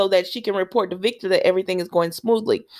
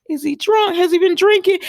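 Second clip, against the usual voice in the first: mock shocked; 11 semitones higher, 5 dB louder than usual. [name] shocked at Johan.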